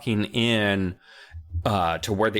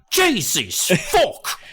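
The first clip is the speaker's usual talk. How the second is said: much higher, louder, very slow mock British oath; British swear; 12 semitones higher, 4 dB louder, much slower than usual. Joking about British swearing, hammy British oath. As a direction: drag out a hammy British curse. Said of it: "the oft British swear".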